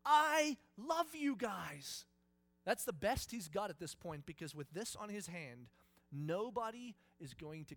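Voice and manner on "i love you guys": slow, loud, high call across a great distance; drawn-out yell from afar; distant shout. Acted. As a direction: shout it to people far away. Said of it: "stand at a great distance and shout".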